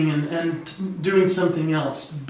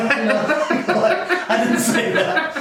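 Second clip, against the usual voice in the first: laughing; 10 semitones higher, slower than usual. Real, not acted. Laughing at own confession.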